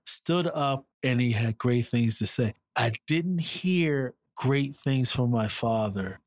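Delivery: usual speaking voice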